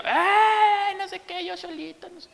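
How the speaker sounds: high mock wail that fades; gritos de berrinche. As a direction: imitate a toddler crying out, then tail off. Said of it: "lo dejé ahí y gritando". (lloriqueo agudo de niño, imitado)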